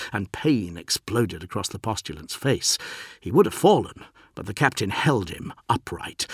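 plain reading voice

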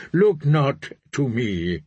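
usual speaking voice

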